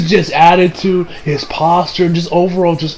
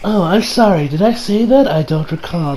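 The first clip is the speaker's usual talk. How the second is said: a light smug fake apology trailing off softer; mock pompous prick; 4 dB quieter than usual. Shigechi feigning he forgot the promise, smug. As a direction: play dumb with a sneer in the voice. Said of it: "perfection there".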